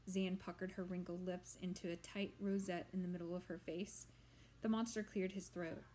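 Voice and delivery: plain reading voice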